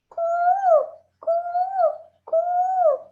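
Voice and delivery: coo, coo, coo, high and loud like a dove; loud cooing call of a bird. Acted. Puppeteer opening the story with a bird call, loud high coo.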